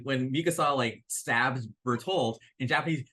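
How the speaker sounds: normal talking voice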